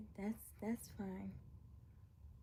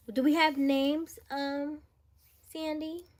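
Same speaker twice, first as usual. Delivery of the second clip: high excited calling out; 7 semitones higher, 4 dB louder, slower than usual. Real, not acted. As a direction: call out eagerly, drawn out. Excited call to sister.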